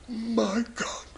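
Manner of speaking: gasped, high whisper of shock; horrified whisper. Acted. Old lady looks into the coffin, horrified whisper. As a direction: whisper it aghast, breath caught.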